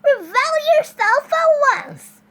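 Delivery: loud, high, slow command; shouted demand. A narrator's reading. Neighbor demands Baby come out, slow high shout. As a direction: order someone out of hiding, loudly. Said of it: "shouted Yesenia".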